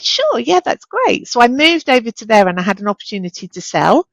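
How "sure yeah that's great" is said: bright, much higher eager acceptance; eager yes. Retold or imitated. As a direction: jump at the offer, voice up high. Eager yes to a job offer.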